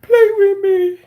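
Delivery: put-on high, eerie little-girl voice calling out; sing-song spooky doll begging someone to play; creepy child voice.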